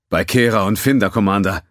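a burly alien, worried; gruff Tellerite. Acted.

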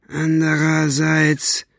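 drawn-out and thoughtful; slow. Acted. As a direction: stretch the word out slowly. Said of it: "sagte er langsam".

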